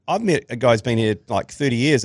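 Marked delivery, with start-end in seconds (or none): none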